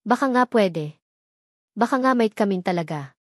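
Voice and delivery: ordinary delivery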